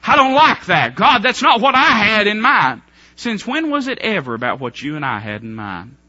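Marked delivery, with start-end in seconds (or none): 0.1-2.8 higher, louder protest at God; mock griping at God; loud complaint. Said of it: "Or what about this?".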